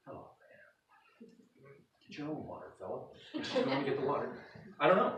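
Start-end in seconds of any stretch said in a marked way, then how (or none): 0.1-3.1 hushed inner grumble; muttered thought. Retold or imitated. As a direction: grumble it under your breath. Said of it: "she's thinking".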